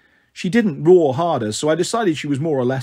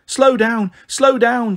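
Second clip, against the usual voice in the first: put-on higher-pitched, mildly insistent little-girl demand; demanding child; 7 semitones higher, 3 dB louder, much slower than usual. A narrator's reading. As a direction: lightly voice a small child giving orders. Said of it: "she demanded".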